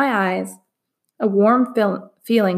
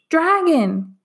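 screaming; 8 semitones higher than usual. A narrator's reading. People scream as the dragon crashes out. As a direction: cry out in terror. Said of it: "they screamed".

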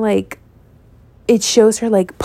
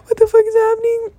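higher and higher, near crying; on the verge of tears; 8 semitones higher than usual. Retold or imitated. Young fan in the theater after the death scene: near tears. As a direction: let it climb toward tears. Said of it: "on the verge of tears".

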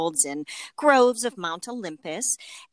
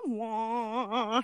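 mock wah sound effect; sad trombone wah; 3 semitones higher, 3 dB quieter than usual. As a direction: imitate a deflating wah-wah sting. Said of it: "a thing that's just the wah".

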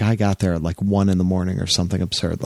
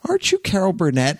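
higher, curious question; curious first lady; 10 semitones higher than usual. Retold or imitated. Lady Bird leaning over the couch to Carol, curious high question. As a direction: lean over and ask, politely surprised.

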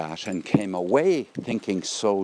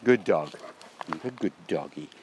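softer, sing-song praise for a dog; tender, swooping good-dog voice; praising a dog; 4 dB quieter than usual. Real, not acted. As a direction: coo approval to your dog. Praising the dog for coming back.

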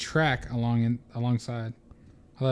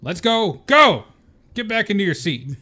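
stern teacher barking orders; yelling; 6 semitones higher, 5 dB louder than usual. Acted. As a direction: shout them back to their seats. Vernon yelling at students.

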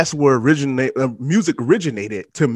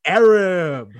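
loud, drawn-out announcer build-up, higher; hype host intro; 8 semitones higher, 5 dB louder, much slower than usual. Acted. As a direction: announce the guest like a ring MC.

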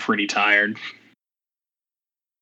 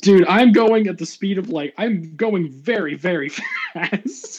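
excited; 7 semitones higher, 3 dB louder than usual. Real, not acted. Excited boast of speed.

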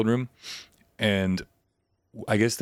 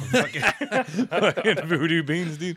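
laughing; 9 semitones higher, much slower than usual. Real, not acted. Laughing bit about voodoo beans.

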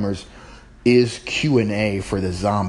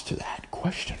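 whisper; 2 semitones higher, 7 dB quieter than usual. Real, not acted. Teasing before playing clip.

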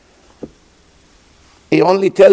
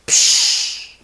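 breathy splash sound; quick unvoiced psh; push whoosh. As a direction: mimic the shove into water. Sound of the father pushing.

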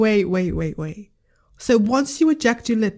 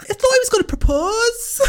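high-pitched through helpless giggles; laughing; 11 semitones higher than usual. Real, not acted. Reacting to the retold Geordie Shore scene, laughing.

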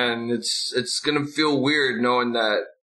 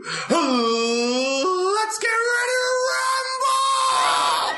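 long drawn-out rumble call, much higher; ring announcer; much slower than usual. Acted. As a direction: stretch it out like a boxing announcer. Classic ring announcer catchphrase.

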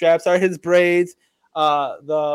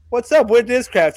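higher, louder salesman's hello; a keen brand rep making the first approach; eager sponsor pitch; 6 semitones higher, 5 dB louder than usual. Retold or imitated. Sponsor pitching a disc golfer.

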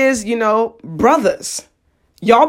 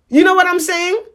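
ordinary delivery

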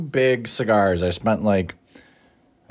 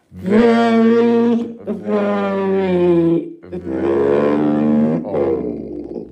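exaggerated drawn-out wail; 8 semitones higher, 3 dB louder, much slower than usual. Drawn-out mock old-man wail.

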